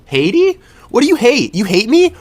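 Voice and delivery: high, loud put-on questioning; mock disbelief. Someone mishearing the country's name, in loud mock disbelief. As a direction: squawk it as indignant, baffled questions.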